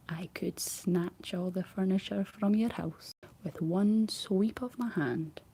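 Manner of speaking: usual speaking voice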